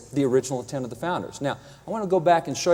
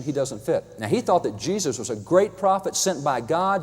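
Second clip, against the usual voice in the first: ordinary talk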